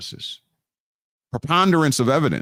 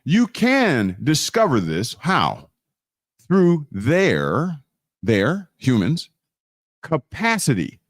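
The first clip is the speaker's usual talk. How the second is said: ordinary delivery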